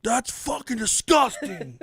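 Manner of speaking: high, drawn-out strained mock scream. Shown as a demonstration. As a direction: stretch it out like screaming from afar. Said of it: "But I'll scream it".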